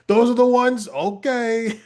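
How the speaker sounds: put-on high, puzzled old man; lightly voiced old man, unsure; confused old man. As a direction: play a confused old gent. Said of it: "he's an older gentleman".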